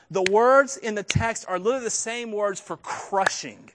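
ordinary delivery

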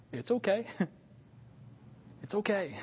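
soft gentle reassurance; reassuring. Retold or imitated. Preacher soothing his own worry, soft and gentle. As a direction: settle the fear softly. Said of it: "Or will I say".

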